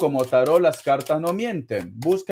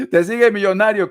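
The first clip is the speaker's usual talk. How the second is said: laughing it out, a bit louder and higher; 4 semitones higher, 6 dB louder, faster than usual. Real, not acted. Tarot reader teasing a caller about her millionaire, laughing. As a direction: tease her through a laugh.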